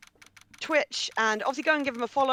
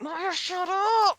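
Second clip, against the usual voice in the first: mock exasperated; 9 semitones higher, much slower than usual. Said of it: "You're like".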